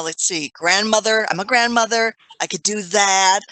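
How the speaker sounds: ordinary delivery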